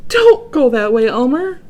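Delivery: loud, high monkey call; monkey's warning. A narrator's reading. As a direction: call out from the trees. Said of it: "said the monkey".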